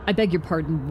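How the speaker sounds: ordinary talk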